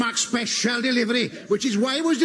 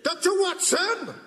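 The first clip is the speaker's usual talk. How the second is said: slow, higher megaphone call; through a megaphone; 5 semitones higher than usual. Acted. Hamish announcing the royal carriage through a megaphone. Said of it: "holding a megaphone in his hand".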